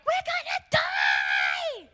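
hammed-up panicked cry, drawn out; terrified shout. Terrified fishermen crying out in a storm, hammed-up panic. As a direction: wail it slowly in mock terror. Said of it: "become so afraid and terrified".

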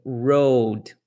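road with an American retroflex R; English R demo. Shown as a demonstration. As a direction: say road, curling the tongue back for the R.

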